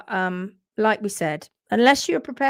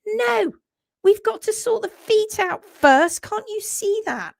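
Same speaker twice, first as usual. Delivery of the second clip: higher, pleading and insistent; exasperated owner; 10 semitones higher, 3 dB louder than usual. Retold or imitated. Owner pleading with the vet to sort the feet, exasperated. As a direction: beg the vet to look at the feet first. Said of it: "And you're going".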